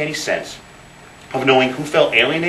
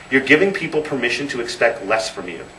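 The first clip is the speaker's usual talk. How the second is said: normal talking voice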